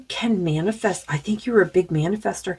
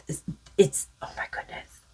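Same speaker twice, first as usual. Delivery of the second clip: breathless whisper of amazement; awed whisper; 2 semitones lower, 7 dB quieter than usual. Real, not acted. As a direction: whisper it, stunned by the card. Awed at the snake card.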